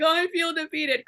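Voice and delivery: high, whiny imitation of crying; mock crying. Woman retells repeating Leo's line, high mock-crying voice. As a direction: wail it lightly, making fun of yourself. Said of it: "crying, you know".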